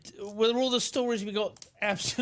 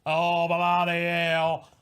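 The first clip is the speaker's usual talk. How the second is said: slow, drawn-out Aussie "Oh, bloody hell"; Aussie accent; 4 dB louder, slower than usual. Acted. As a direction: lean into the Australian accent. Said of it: "in his flawless Aussie accent".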